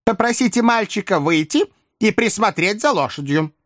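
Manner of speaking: put-on high-pitched old-lady voice. A narrator's reading. Old lady giving orders to her coachman, high voice. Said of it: "возразила старая леди".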